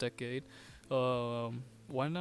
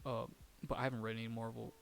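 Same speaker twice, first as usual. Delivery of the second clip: normal talking voice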